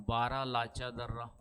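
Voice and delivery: stretched out, syllable by syllable; teacher drilling Baralacha slowly; slow repeat for memorising. Shown as a demonstration. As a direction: say it slowly so students remember Baralacha. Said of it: "याद रखना है".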